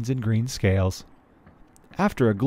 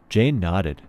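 usual speaking voice

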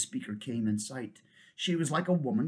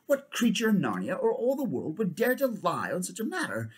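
higher, indignant royal challenge; fierce king; 8 semitones higher, 3 dB louder than usual. Acted. King challenging the centaur's claim, fierce. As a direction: demand it in outrage. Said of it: "said the king fiercely".

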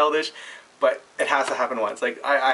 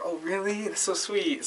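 imitated sweet gushing; 6 semitones higher, 7 dB quieter, slower than usual. Waitress touched by the hug offer.

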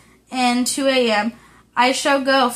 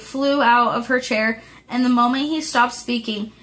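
plain reading voice

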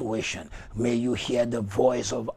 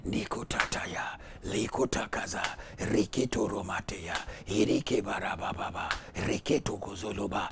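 soft, lowered prayer in tongues; hushed tongues; 4 semitones lower, 7 dB quieter than usual. Real, not acted. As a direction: pray on softly after the outburst. Tongues after the climax.

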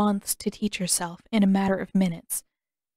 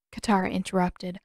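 ordinary delivery, nothing marked

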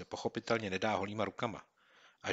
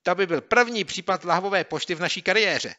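much higher, louder, thrilled; bubbling with excitement; eager boy; 10 semitones higher, 10 dB louder than usual. Acted. Boy to his friend about bottle mail, thrilled.

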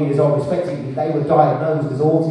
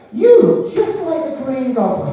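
put-on child's blunt remark; 8 semitones higher, 3 dB louder than usual. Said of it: "and says".

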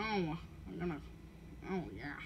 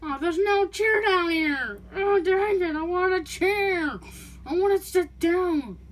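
whining; 7 semitones higher, 8 dB louder, slower than usual. Acted. Whining for a chair.

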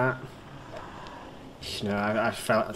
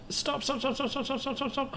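high, hushed rapid-fire stops; panicked pleading; 14 semitones higher, 7 dB quieter than usual. Real, not acted. Player begging the ball to stop, panicked. Ball rolling past the target.

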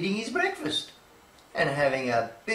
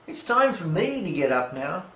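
usual speaking voice

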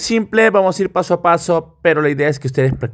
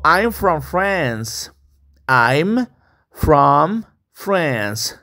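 slow model line; much slower than usual. Shown as a demonstration. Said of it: "slow model for repetition".

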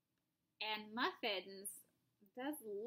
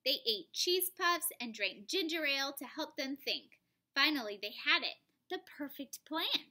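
louder animated read-aloud; lively story narration; 3 semitones higher, 4 dB louder than usual. A narrator's reading. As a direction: read it with big storybook energy.

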